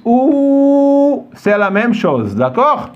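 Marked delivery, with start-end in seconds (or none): 0.0-1.3 OU sustained on a high note; long, even, high OU; sound demo. Shown as a demonstration. Teacher repeats the shared sound, OU held long and high.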